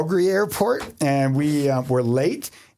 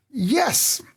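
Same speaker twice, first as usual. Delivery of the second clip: eager yes; 10 semitones higher than usual. Retold or imitated. Eager answer to customs question.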